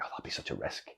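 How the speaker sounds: hushed, breathy whisper of doubt; whispered worry. Retold or imitated. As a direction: murmur the risk to yourself under your breath. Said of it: "the words I said".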